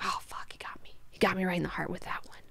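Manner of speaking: hushed, whispered mock defeat; stung aside. Duncan stung by Leto's pitying answer, hushed aside. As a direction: whisper it as if privately wounded.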